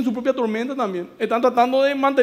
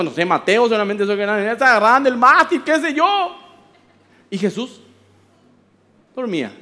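normal talking voice

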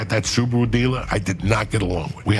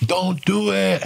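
slow, high, drawn-out warning; stretched mock yell, pitched high; 10 semitones higher, much slower than usual. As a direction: call it out to the whole line. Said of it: "So I'd be yelling at them".

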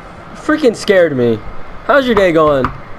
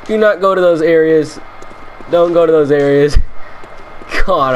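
ordinary delivery

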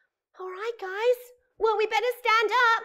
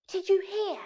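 plain reading voice

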